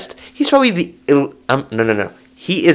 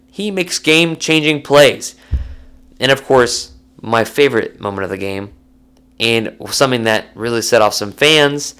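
usual speaking voice

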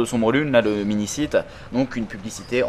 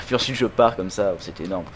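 ordinary delivery

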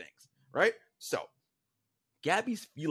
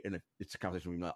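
ordinary talk

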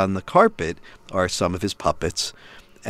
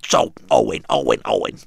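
father voicing the parrot puppet to his son; Gilbert Gottfried as Iago. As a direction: squawk "Owen, Owen" like the Iago puppet. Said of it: "It's Gilbert Gottfried's voice".

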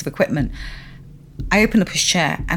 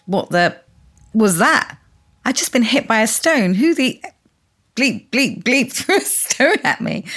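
higher-pitched mock outrage; retold indignant outburst; 5 semitones higher, 4 dB louder than usual. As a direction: act out a startled, indignant exclamation. Husband quoted reacting to stone.

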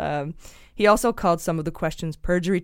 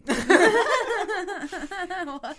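high burst of laughter; 13 semitones higher than usual. Real, not acted. Host cracks up at the gyno joke, high laughter.

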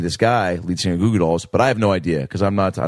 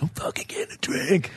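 whisper; 6 semitones higher, 8 dB quieter than usual. Retold or imitated. Whispering to friends across bar.

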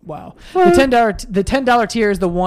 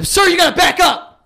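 mock conductor bark; 11 semitones higher, 5 dB louder than usual. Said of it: "I'm like".

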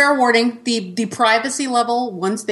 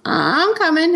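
put-on child's voice; 10 semitones higher, slower than usual. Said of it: "and he goes".

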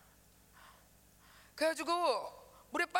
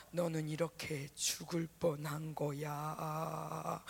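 low, slow ominous voice; slow and low, like a menacing whisper in the head; haunting inner voice; 8 semitones lower, 4 dB quieter, much slower than usual. Retold or imitated. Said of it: "이렇게 자꾸 들리는 거야". (낮고 느린 불길한 목소리)

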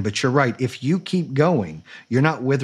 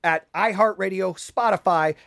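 high, upbeat announcer read; podcast outro; 8 semitones higher than usual. Acted. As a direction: hype up the sign-off like a radio host.

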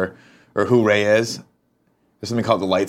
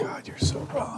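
whispered; 11 dB quieter, slower than usual. Real, not acted. Reacting to Star Wars insult.

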